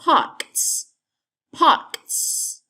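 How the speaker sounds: parks with a long voiceless s at the end; parks, high start and a hissed ks ending; hissed final S. Shown as a demonstration. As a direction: stretch the end of parks into sss. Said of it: "Và giống như chữ topic".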